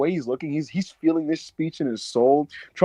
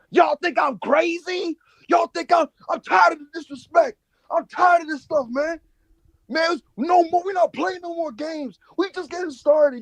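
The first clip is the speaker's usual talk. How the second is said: mad rant; 12 semitones higher than usual. Retold or imitated. Imitating Jacob Fatu ranting angrily on SmackDown. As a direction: bellow it like a fed-up heel cutting a promo. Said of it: "instructed to just be mad and rant".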